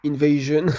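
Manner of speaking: trying again to say "Invasion" the English way; English accent. Shown as a demonstration. Gamer retries "Invasion" in a shaky English accent. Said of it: "Mon accent anglais est très pourri".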